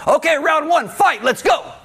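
playful fight announcer, laughing; mock fight announcer. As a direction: shout it like a video game round start. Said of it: "A demon doesn't go".

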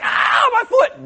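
high, slow mock yelp of pain; pained Balaam. Balaam's foot pressed against wall, high slow hammed-up yelp. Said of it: "And Balaam's like".

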